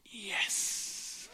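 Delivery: breathy, voiceless acceptance; mock-weepy whispered answer; imitated tearful yes. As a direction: whisper it as if overcome by tears. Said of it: "and she's crying, she says".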